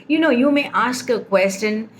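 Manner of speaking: ordinary talk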